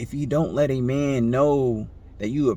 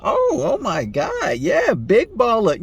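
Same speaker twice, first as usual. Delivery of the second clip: much higher, slow, gushing mock awe; impressed girlfriend; 10 semitones higher, 5 dB louder, slower than usual. Girlfriend impressed by her date ordering snacks, gushing. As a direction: gush over him like he's a big spender. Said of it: "And the girl's like".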